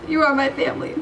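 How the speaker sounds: ordinary talk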